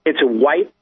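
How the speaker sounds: ordinary talk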